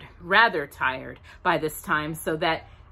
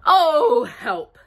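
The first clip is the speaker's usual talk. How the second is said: high startled oh, then a small help; a falling bear's yelp; mild cry for help; 9 semitones higher, 6 dB louder than usual. Acted. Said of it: "said Pooh".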